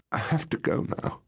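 high, partly voiceless, restrained sob; narrated tearful voice; sobbed. A narrator's reading. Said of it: "she sobbed".

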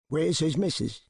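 put-on gruff old man. Old House asks Smallwood, curt old man. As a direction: snap the question at him.

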